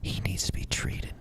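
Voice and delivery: hushed voiceless whisper. Real, not acted. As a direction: whisper it like a secret. Whispered aside.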